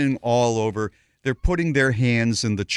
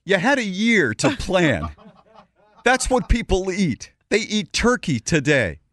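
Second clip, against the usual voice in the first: indignant Bob; 6 semitones higher than usual. Retold or imitated. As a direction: make the complaint sound outraged. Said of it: "I become Bob".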